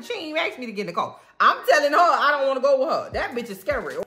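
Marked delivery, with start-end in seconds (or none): none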